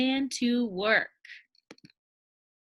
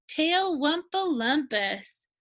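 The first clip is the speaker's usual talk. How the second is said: usual speaking voice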